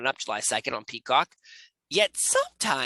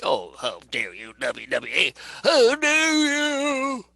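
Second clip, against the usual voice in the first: mock outrage; 6 semitones higher, 3 dB louder, slower than usual. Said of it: "it's nothing like".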